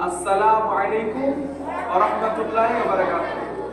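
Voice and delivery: put-on slow, careful full salam for children to repeat; slow model salam. Shown as a demonstration. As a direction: spell out the full greeting slowly. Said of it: "তাহলে এইটা হচ্ছে সালাম।". (শিশুদের জন্য ধীরে পুরো সালাম)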